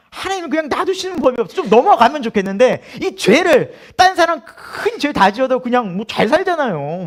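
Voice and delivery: high-pitched, whiny protest; mock complaint. Believer protesting God never lets sins slide, comic whine. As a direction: grumble loudly at how unfair it is.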